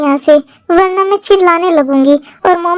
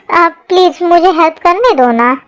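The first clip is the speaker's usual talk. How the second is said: ordinary delivery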